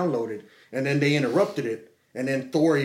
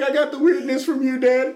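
teasing daughter; 11 semitones higher, 5 dB louder than usual. Retold or imitated. Daughter's voice quoted.

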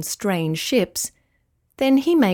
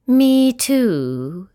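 unhappy voice; 3 semitones higher, 4 dB louder than usual. A narrator's reading. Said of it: "said another unhappy voice".

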